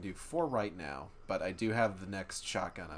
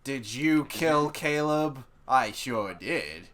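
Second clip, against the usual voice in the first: slow, loud cartoon character voice; Homestar Runner voice; 4 semitones higher, 12 dB louder than usual. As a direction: drawl it like a goofy cartoon. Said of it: "You were doing a Homestar voice".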